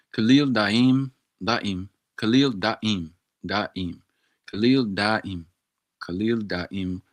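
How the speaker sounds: "Khalil daim" repeated slowly with the stress on Khalil; slow drill. Shown as a demonstration. Repeating the lyric to get it right, slow drill. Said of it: "drilling the phrase".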